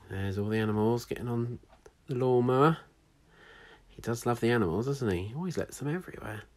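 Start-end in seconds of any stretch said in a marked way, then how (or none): none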